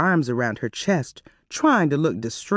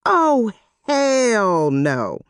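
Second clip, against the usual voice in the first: outraged disbelief; 6 semitones higher, much slower than usual. A narrator's reading. Terry's inner outraged reaction.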